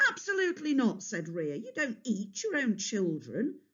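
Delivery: high, indignant scolding; scolding mother. A narrator's reading. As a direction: tell him off firmly. Rhea scolds Kronos.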